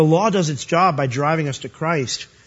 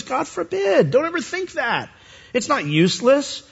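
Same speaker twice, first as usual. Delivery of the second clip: raised, insistent denial; higher-pitched preacher voicing Paul's protest; emphatic Paul; 9 semitones higher than usual. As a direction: forbid it firmly, pitched up. Paul forbids the idea.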